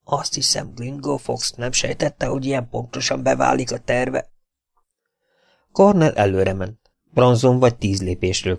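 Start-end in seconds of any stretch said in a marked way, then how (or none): 0.0-4.3 hushed. A narrator's reading. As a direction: breathe it out under your breath. Conspirators talking hushed in cave.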